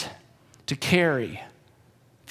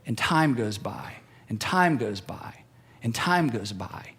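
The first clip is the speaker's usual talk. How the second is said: low and slow, each "time goes by" falling; weary slow repeat; slow repetition; 9 semitones lower, 5 dB quieter, slower than usual. Real, not acted. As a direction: let "time goes by" drag, lower and slower. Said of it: "repeated slow low phrase".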